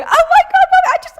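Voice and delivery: very high, loud imitation of a child wailing; mock tantrum squeal; upset child. Daughter melting down at her mother in the shop, mock upset wail. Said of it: "She starts getting really upset".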